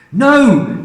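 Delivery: high, loud sudden No; alarmed cry. Acted. Missus Cranky stopping her mother, alarmed high cry. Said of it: "cried Missus Cranky".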